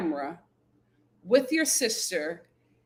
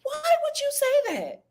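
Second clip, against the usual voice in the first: stunned disbelief. Retold or imitated. Recalling pausing the episode, high incredulous. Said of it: "I was like".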